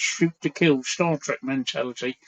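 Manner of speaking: usual speaking voice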